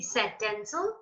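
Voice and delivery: ordinary delivery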